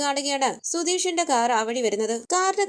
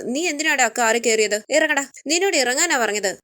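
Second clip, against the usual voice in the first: plain reading voice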